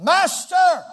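loud, high shout; panicked cry. Retold or imitated. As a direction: call out in alarm. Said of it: "and said unto him".

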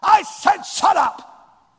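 slow, high, furious yell; angry yell. Retold or imitated. Parent yelling at a child to shut up, angry. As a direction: shout a parent's angry order. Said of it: "we have to yell at them".

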